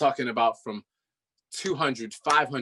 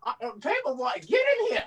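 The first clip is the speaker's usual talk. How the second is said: shrieking grandma; 5 semitones higher, faster than usual. Acted. Grandma yelling for the boy to come in, much higher. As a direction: holler for the boy like an old grandma. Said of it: "this is Paperboy's grandmother".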